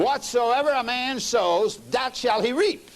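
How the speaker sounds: forceful scripture. Acted. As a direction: lean on each word of the verse.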